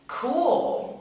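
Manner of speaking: high-pitched, drawn-out "cool"; feigned casual approval. Retold or imitated. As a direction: say a long, loud, high "cool" to brush him off. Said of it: "a little more change in my tone of voice".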